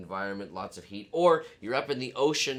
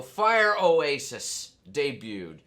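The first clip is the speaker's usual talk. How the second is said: slow, drawn-out big reveal; grand announcement; 3 semitones higher, 7 dB louder, much slower than usual. Acted. Show opening, grand announcement.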